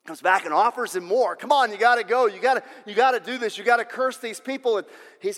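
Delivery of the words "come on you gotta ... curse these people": pushy king. Acted. King pressures the prophet.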